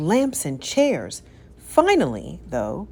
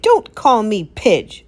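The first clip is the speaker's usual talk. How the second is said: slow, loud angry retort; loud slow cold snap; snapping; 5 semitones higher, 6 dB louder, slower than usual. A narrator's reading. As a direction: snap at him. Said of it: "snapped Lady".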